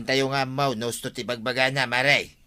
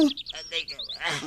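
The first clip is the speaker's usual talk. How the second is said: pained moan; 4 dB quieter than usual. Acted. Injured man moaning after fall.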